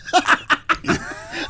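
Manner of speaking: high, airless giggle fit; breathless wheezing laugh. Real, not acted. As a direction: silent shaking laugh. Retelling absurd phone call.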